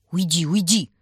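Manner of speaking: loud officer's order; loud, brusque warning-off; commanding bark. A narrator's reading. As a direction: bark it after someone like a commander. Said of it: "рявкнул ей вслед кто-то командирским голосом".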